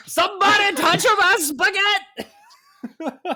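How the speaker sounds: high, slow, hammed-up Italian plumber cry; Mario meme voice. Joking to a friend about a Mario restaurant, hammed-up Mario voice. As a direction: ham up the spaghetti meme like Mario. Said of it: "have Mario fully act out that meme".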